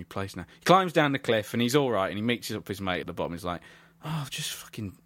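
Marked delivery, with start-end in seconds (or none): 4.0-4.9 whispered, sheepish climber act; breathy, under-the-breath mock dismay; hushed mock confession. Voicing climber confessing to his mate.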